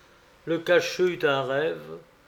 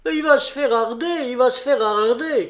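high, loud cry of alarm from dwarfs; cri des nains; 9 semitones higher, 6 dB louder than usual. A narrator's reading. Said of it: "crièrent les nains". (cri aigu et fort)

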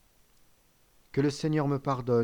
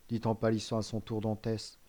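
plain reading voice

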